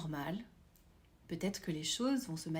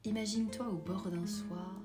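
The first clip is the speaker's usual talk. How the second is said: guided meditation; 9 dB quieter than usual. Acted. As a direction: guide them gently into calm.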